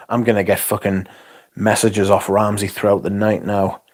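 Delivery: usual speaking voice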